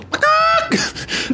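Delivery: very high cackling squawk; mock animal squawk. Shown as a demonstration. Player demonstrating how talking to a chicken might sound, squawking very high. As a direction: squawk like a bird. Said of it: "if I straight up just go".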